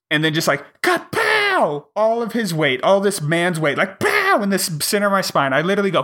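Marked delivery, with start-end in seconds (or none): 0.8-1.9 high, sharp kapow impact noise; cartoon punch sound; kapow sound effect. Mimicking the chiropractor's blow. 3.8-4.4 high, sharp pow impact noise; cartoon punch sound; pow sound effect. Mimicking the chiropractor's blow.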